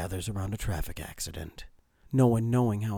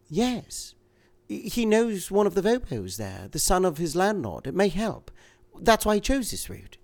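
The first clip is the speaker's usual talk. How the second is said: higher, anxious woman's voice; a frightened wife, urgent; 11 semitones higher, 6 dB louder than usual. A narrator's reading. Woman character in reading.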